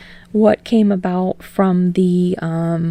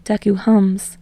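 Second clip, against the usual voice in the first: ordinary delivery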